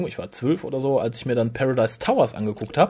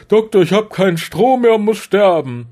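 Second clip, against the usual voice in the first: loud, higher, hammed-up death plea; mock pathetic dying voice; 5 semitones higher, 5 dB louder than usual. Host mocking Face of Bo's death scene, hammy pathetic plea. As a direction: wail it as a pitiful parody. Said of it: "die Szene relativ erbärmlich fand".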